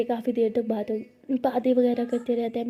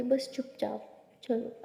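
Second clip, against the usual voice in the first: hushed; 8 dB quieter, slower than usual. Acted. Keeping a surprise secret.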